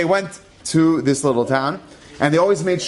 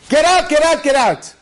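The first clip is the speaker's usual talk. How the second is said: loud, high yelled order; soldier yelling; 10 semitones higher, 4 dB louder than usual. Retold or imitated. Soldiers storming the house, yelling at the family. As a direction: drive them out with a yell.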